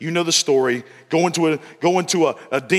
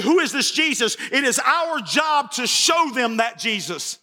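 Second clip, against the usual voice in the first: higher, rousing sermon climax; preaching at full force; 9 semitones higher than usual. Acted. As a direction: lift the congregation with each question.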